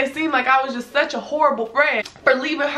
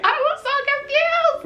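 very high squeal on "I was so confused"; hammed-up, sky-high bewildered repeat; squealed bewilderment. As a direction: push "confused" up into a thin squeal.

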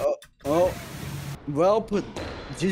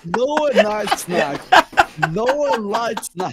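laughing; 6 semitones higher, 4 dB louder, much slower than usual. Real, not acted. Laughing tease about Noah.

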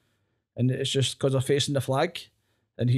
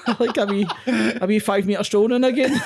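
louder, laughing through the line; laughing self-mockery; 7 semitones higher, 5 dB louder than usual. Real, not acted. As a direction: joke about yourself while laughing. Self-mocking joke about walking.

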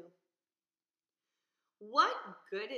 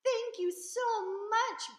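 much higher, louder, slow delighted thanks; thrilled little fish squealing thanks; excited burble; 13 semitones higher, 10 dB louder, much slower than usual. Acted. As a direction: gush thanks with joy. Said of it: "burbled ... with excitement".

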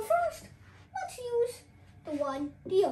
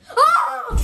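startled yelp; 10 semitones higher, 15 dB louder than usual. Real, not acted. Physical bit, startled yelp.

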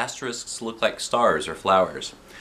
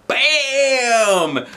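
high, loud triumphant shout; hollered; 6 dB louder than usual. Acted. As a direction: crow over the hit. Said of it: "Alexia hollered".

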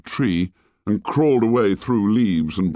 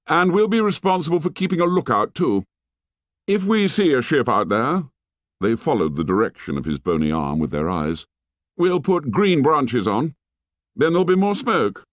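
put-on raised, bold boy leader voice; Jack's voice; 7 semitones higher than usual. A narrator's reading. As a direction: play the confident young leader. Narrator voices Jack.

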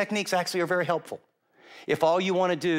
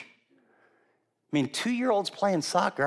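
ordinary talk

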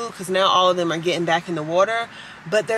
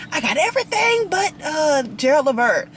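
high-pitched playful mom impression; imitating mom; 8 semitones higher, 3 dB louder than usual. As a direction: quote mom's complaint in a much higher voice. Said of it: "she was like".

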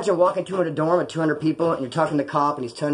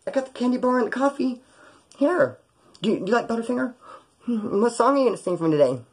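much higher, sweet fawning voice; mock eager fan; 7 semitones higher than usual. Pretend fan brings candy bar to singer, hammed-up fawning voice. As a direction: play the gushing groupie, hammed-up. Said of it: "come later and be like".

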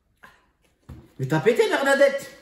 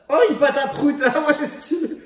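laughing; 9 semitones higher, 4 dB louder than usual. Real, not acted. Laughing at fart putty.

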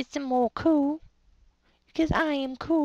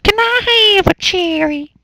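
higher, loud whiny begging voice; much higher, louder pleading child; put-on kid voice; 7 semitones higher, 12 dB louder than usual. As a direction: beg like a little child.

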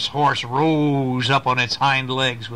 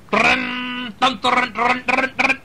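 galloping hooves; 11 semitones higher, slower than usual. Acted. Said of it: "the horse saw the owl and just went".

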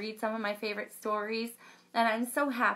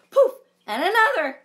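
louder, higher poof and another; exasperated; 10 semitones higher, 11 dB louder than usual. Shown as a demonstration. Storyteller reacting to more unicorns appearing, exasperated. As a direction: throw up your hands at yet another. Counting magic poofs.

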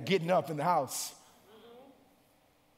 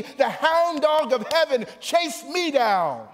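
raised, driving sermon voice; full-force preaching; 7 semitones higher, 6 dB louder than usual. Real, not acted. Preacher telling the church that grace found him, full-force preaching. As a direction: drive it home, drawing out the closing phrases.

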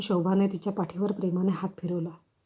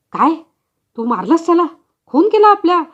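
rising to a much higher, much louder scream; horrified shout; 8 semitones higher, 10 dB louder than usual. Acted. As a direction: build from shock into a frantic yell. Husband learns she killed baby. (धक्का बसून किंचाळणे)

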